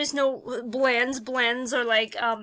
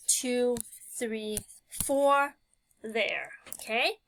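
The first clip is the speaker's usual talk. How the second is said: normal talking voice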